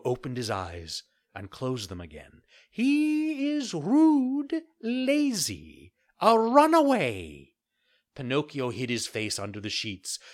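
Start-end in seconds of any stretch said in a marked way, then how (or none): 2.7-7.5 much higher, louder, very slow cricket scolding; a tiny insect denouncing a rascal. Acted. Cricket lists faults.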